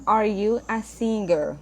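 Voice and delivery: slow, careful English question; slow model reading. Shown as a demonstration. Teacher modelling a question for the class, slow and clear. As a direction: read it slowly so students can follow. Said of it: "teacher reads example question".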